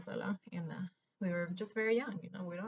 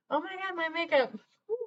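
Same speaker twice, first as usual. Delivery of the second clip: higher, louder sudden dismay; startled exclamation; 10 semitones higher, 4 dB louder than usual. Real, not acted. Vlogger notices her makeup after crying, startled. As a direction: react as if you just saw your smudged makeup.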